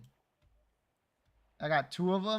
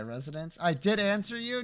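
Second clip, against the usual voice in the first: ordinary talk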